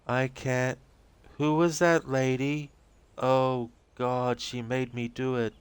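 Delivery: high, slow, shaken voice; scared and lost, reaching for words; frightened Rose. Acted. Rose confessing to the agent, high and frightened.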